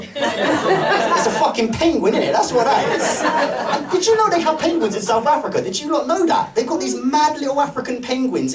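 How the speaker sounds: laughing disbelief. Real, not acted. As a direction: crack up while protesting it. Incredulous mocking rant.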